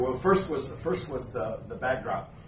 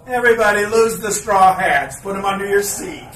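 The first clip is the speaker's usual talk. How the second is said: much louder and higher, calling to a crowd; megaphone announcement; 8 semitones higher, 14 dB louder than usual. Retold or imitated. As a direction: bark it through a megaphone. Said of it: "a big megaphone. He goes".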